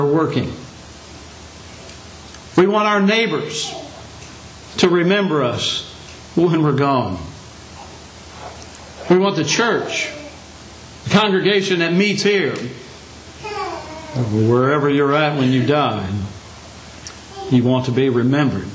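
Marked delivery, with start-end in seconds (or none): none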